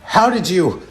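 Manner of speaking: high, startled questioning. Acted. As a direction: blurt it out, caught off guard. Startled villain is found out.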